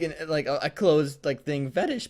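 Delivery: ordinary talk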